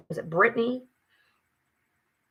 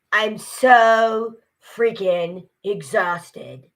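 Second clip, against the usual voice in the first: put-on loud, very drawn-out movie-character accent; 5 dB louder, much slower than usual. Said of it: "in the character's voice".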